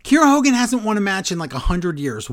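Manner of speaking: high, rapid, loud objection; incredulous protest. Real, not acted. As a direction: blurt it out fast and high in disbelief. Incredulous protest over title shot.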